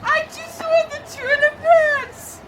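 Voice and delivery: very high excited shriek; shrieking jackpot winner. Acted. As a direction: scream with disbelieving joy.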